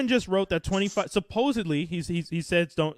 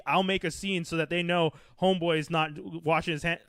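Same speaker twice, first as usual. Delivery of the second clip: normal talking voice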